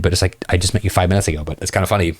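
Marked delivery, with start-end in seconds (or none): none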